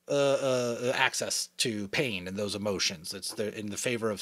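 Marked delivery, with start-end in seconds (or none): none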